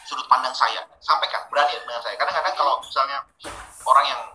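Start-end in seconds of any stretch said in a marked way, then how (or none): none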